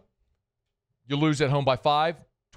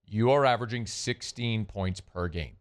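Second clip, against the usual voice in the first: slow, deliberate, with weight on "are"; slowly; 7 semitones lower than usual. Shown as a demonstration. Host repeats the scoring stat to the Saints, slow and deliberate. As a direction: spell it out word by word so it sinks in. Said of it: "Let me say that again slowly".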